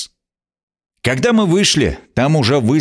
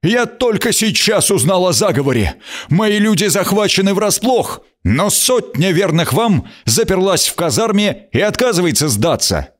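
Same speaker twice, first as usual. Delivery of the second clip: urgent, higher report, voice cracking; voice breaking; 8 semitones higher than usual. Acted. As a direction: blurt the news with a breaking voice. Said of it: "срывающимся голосом".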